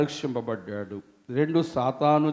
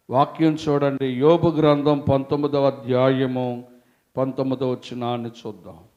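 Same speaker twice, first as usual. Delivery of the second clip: ordinary delivery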